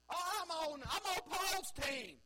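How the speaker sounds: hammed-up partisan shout; mock shout. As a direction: shout it like a Paul fan cheering his side. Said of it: "They're playing".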